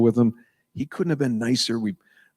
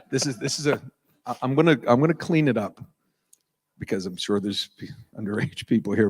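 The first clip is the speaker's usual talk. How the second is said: ordinary delivery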